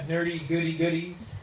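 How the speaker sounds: ordinary delivery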